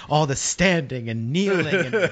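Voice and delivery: lisping teasing voice, slow; mock flamboyant quip. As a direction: drawl it out like a sassy friend.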